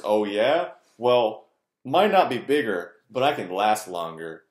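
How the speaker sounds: loud, cocky one-upping brag; mock boast. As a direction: show off like a bragging rival. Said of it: "I'm making fun of that".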